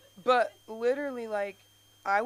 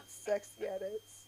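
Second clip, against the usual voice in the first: sultry whisper; 13 dB quieter, slower than usual. Acted. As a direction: purr it seductively. Breathy sultry aside.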